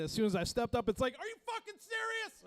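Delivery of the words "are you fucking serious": much higher mock outraged voice; the creaky stage complaining about his weight; voicing the stage. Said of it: "The stage is definitely feeling it".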